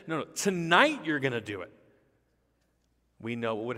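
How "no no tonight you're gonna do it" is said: loud, raised, certain rebuttal; emphatic correction. Retold or imitated. Jesus tells Peter it happens this very night, emphatic correction. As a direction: drive the verdict home forcefully.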